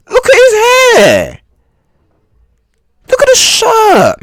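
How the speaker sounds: high, loud, sneering "look at his hair"; mocking disbelief. Real, not acted. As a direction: point and jeer at his outfit. Mocking a man's looks.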